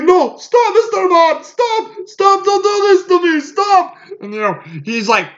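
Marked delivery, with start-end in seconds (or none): 0.0-3.9 much higher, frantic begging; mock panicked pleading. Imitating the villain pleading with Bond to stop, high and frantic. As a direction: squeal it like a villain begging for mercy. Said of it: "freaking out".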